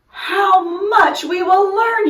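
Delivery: high, loud, gushing principal voice; a proud principal carried away with delight; excited principal. A narrator's reading. Mister King's excitement.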